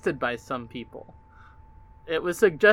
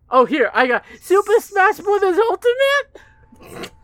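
squeaky mock surprise; 14 semitones higher, 6 dB louder than usual.

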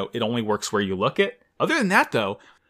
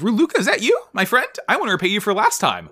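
eager pilot voice; 9 semitones higher than usual. Retold or imitated. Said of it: "and he's like".

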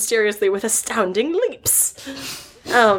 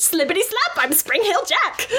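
Spring Hill Jack voice; 9 semitones higher than usual. Retold or imitated. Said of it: "said my favorite phrase".

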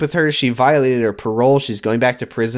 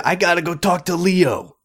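a lightly voiced higher Leo; 5 semitones higher than usual. [name] quoting Leo to Ricky, lightly voiced Leo. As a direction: slip into Leo's voice. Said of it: "You did Leo voice".

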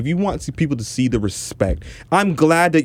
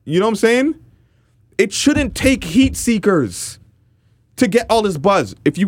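indignant rant; 10 semitones higher than usual. Real, not acted. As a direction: rant at full tilt, pitch climbing high and words stretched out. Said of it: "my rant".

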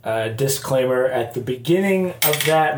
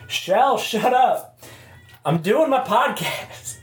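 raised, higher voice at the cat; scolding the cat; 9 semitones higher than usual. Real, not acted. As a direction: shout at the cat to hush. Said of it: "that's our cat Shell".

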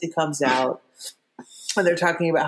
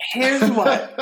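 laughing; 5 semitones higher, 3 dB louder than usual. Real, not acted. Laughing aside.

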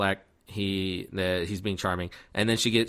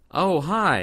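much higher, louder mock greeting; awkward surprised greeting; 9 semitones higher, 5 dB louder than usual. She meets his kids at the door, awkwardly surprised. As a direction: imitate a thrown, too-bright hello.

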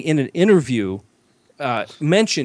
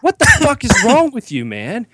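mock outraged yell; 12 semitones higher, 5 dB louder than usual. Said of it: "He's like".

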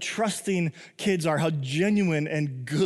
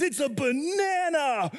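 put-on high, slow, thrilled child's voice; excited little kid; 10 semitones higher, slower than usual. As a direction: squeal it like a toddler unwrapping a gift. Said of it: "they're just losing their minds".

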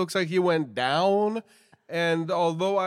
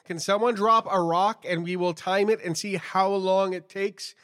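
ordinary talk

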